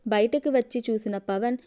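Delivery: ordinary delivery, nothing marked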